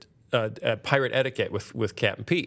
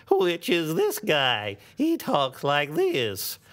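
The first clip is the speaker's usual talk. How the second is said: slow cartoon grape voice; Tom the Grape voice; 3 semitones higher, slower than usual. Shown as a demonstration. Host demos Tom the Grape's voice for a fan, slow. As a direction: drawl it out slowly like the old grape. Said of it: "the voice of Tom the Grape from VeggieTales".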